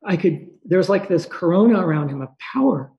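usual speaking voice

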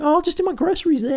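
high, breezy mock shopper's voice. Mocking people who shop at IGA, high parody voice.

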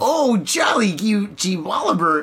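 high, prissy mock-polite exclamation; mock prim clean talk. Mocking the friend who cleaned up his swearing, high and prissy. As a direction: parody someone too proper to swear. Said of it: "I don't even know how to say good words anymore".

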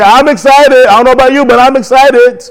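high-pitched, rousing excitement; excited declaration. Shown as a demonstration. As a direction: proclaim it high to fire up the congregation. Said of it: "who are not excited, say".

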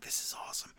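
breathless whispered delight; whispered glee. Retold or imitated. Recalling watching the promo, hushed whispered glee. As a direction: whisper it like a secret thrill.

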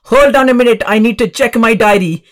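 mother imitated in a hammed-up Indian accent; mock Indian accent. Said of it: "I just like to do the Indian accent".

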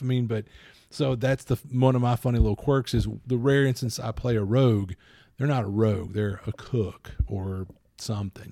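normal talking voice

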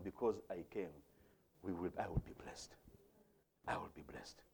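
very low, soft recital; quiet, low preacher's affirmation; hushed song quote. Acted. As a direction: murmur the words like a private assurance.

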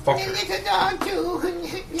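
squealed, slow, laughing "oh, motherfucker"; high laughing dismay. Real, not acted. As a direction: wail it high and drawn out through a laugh. Player reacts to dog attacked.

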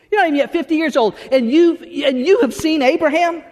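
high mock disbelief, rising to the name; incredulous challenge.

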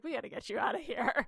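lightly voiced husband hurrying her out; mock hushed husband. Husband to laughing wife, mock hushed urging.

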